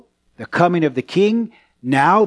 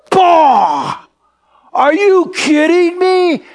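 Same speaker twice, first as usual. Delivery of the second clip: loud, high, drawn-out mind-blown outburst; startled amazement; 14 semitones higher, 7 dB louder, much slower than usual. Retold or imitated. Young believer reacting to a startling Bible passage, loudly astonished. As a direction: re-enact a mind being blown, full out. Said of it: "so startling to me and amazing".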